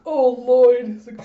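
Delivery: high, loud mock-emotional cry; hammed-up swooning exclamation; overcome wife. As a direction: gush as if moved to tears. Wife overcome by husband's praise.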